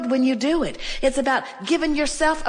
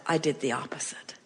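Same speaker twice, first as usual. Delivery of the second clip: breathy hushed whisper, much softer; 7 semitones lower, 9 dB quieter than usual. Real, not acted. Speaker to audience, hushed whispered aside. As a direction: drop to a whisper for the confession. Quiet aside about beating oneself up.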